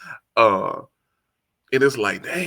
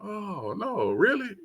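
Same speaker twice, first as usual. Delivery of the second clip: surprised youngster; 10 semitones higher, 5 dB quieter, slower than usual. Retold or imitated. Youngster's surprised reply acted out.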